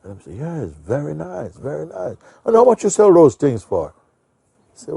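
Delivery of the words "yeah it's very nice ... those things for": higher, warming to it, louder at the end; pleased customer. Retold or imitated. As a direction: won over and keen to buy. Old man now pleased, asking price.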